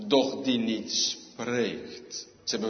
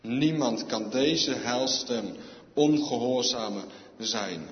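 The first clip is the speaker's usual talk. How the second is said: ordinary talk, nothing marked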